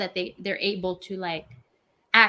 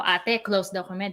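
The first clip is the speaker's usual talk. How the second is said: normal talking voice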